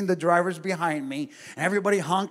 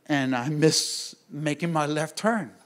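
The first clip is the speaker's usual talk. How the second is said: ordinary talk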